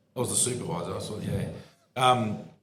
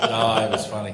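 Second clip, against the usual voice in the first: normal talking voice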